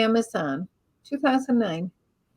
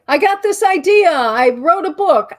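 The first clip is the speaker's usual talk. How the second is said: higher and louder, breezy smugness; mocking other authors; 4 semitones higher, 8 dB louder than usual. Mimicking authors who claim it was easy, loud and breezy. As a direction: toss it off as if it were that easy. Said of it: "You know how people say".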